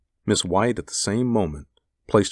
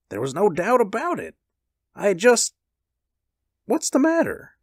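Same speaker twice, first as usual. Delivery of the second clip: high-pitched, emphatic protest; insistent husband; 3 dB louder than usual. A narrator's reading. Husband arguing with his wife, insistent then puzzled.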